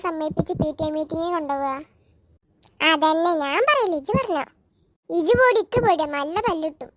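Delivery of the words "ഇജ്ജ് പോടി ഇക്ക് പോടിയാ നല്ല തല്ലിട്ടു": ordinary talk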